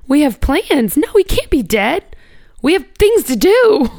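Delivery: high, rising protest against the news; grieving disbelief. Retold or imitated. Reliving first grief over a dead loved one, disbelieving protest. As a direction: plead against it, pitch climbing with each phrase. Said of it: "sadness and disbelief and shock".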